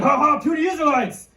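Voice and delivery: big, loud mocking taunt; Goliath. Giant making fun of Israel's army, loud mocking boast. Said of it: "loud voice".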